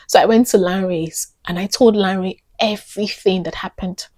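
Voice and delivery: usual speaking voice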